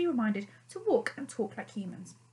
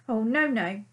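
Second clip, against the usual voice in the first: nervous refusal; 7 dB louder than usual. A narrator's reading. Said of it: "suddenly not as snappy as usual".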